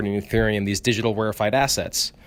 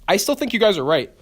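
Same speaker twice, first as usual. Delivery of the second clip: ordinary delivery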